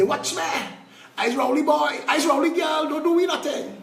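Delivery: high mock shout of a boastful party supporter; mocking party loyalist. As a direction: mimic a cocky loyalist daring anyone to touch him. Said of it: "And you can say".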